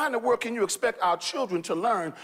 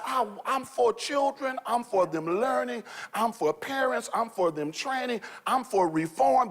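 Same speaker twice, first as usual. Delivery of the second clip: high, measured preacher cadence; passionate declaration; 5 semitones higher than usual. Real, not acted. Pastor declaring what he stands for. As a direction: stress each for like a vow.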